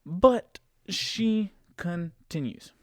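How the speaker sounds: very slow, high "But she continues"; drawn-out exasperation. Real, not acted. Storyteller exasperated that passenger keeps going, "But she continues" drawn out slowly. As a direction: stretch each syllable of "continues" for drama. Dramatic repeat of the story turn.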